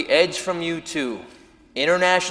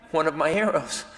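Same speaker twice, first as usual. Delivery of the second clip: normal talking voice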